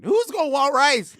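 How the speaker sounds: shouting, pitch jumps high; outraged yell. Real, not acted. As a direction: yell it in disbelief. Outraged repeat about rice.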